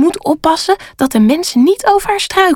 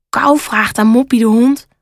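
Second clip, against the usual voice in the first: ordinary delivery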